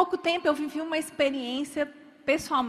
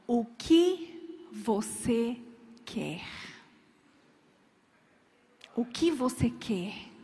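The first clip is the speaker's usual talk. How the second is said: inner divine voice; 3 semitones lower, much slower than usual. Acted. A voice from God questioning the weeping mother, slow and low. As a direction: let each word hang like a voice from within. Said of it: "uma voz dizendo".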